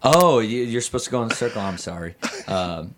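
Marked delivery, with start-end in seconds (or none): none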